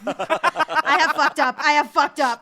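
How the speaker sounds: laughing through a mock panicked confession; loud, slow, laughing "I have fucked up". Panicked realising missed email.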